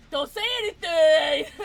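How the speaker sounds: loud, high playful plea; mock pleading shout. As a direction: beg loudly, hamming it up. Pleading with Keisha not to tell.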